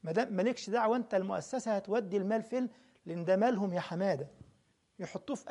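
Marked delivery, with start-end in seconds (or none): none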